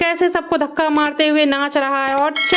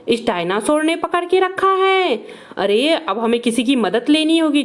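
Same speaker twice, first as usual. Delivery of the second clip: ordinary delivery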